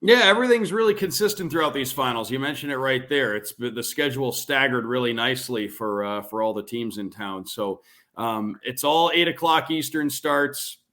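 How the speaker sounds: ordinary delivery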